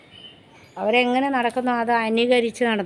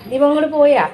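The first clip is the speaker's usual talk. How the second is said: raised, higher call asking after someone; 5 semitones higher, 6 dB louder than usual. Real, not acted. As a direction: call out, checking if he's gone. Asking if neighbour left.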